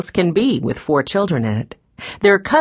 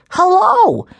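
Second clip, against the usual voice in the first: very high, loud, stretched child's mimicry; boy mimicking curt grandfather; 6 dB louder than usual. A narrator's reading. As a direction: indignantly echo it in a little boy's voice. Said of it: "all he said was".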